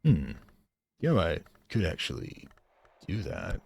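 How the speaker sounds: usual speaking voice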